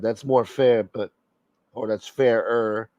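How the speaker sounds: ordinary delivery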